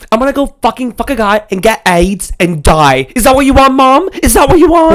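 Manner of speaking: a bratty Australian schoolgirl screeching at her mother; a whiny teen tantrum in an Aussie accent; Ja'mie's Australian accent. Retold or imitated. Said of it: "that accent was much better".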